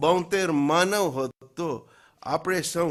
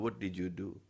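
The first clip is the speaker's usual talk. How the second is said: ordinary talk, nothing marked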